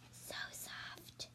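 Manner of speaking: breathy whisper on "so soft"; a soft whispered aside. Acted. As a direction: hush it as if stroking the fleece. Reading soft fleece aloud.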